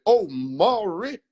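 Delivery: "Omari" slow and rising, each syllable spelled out; overpronounced name. Retold or imitated. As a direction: drag out "Omari" precisely. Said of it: "stop pronouncing shit so sufficiently".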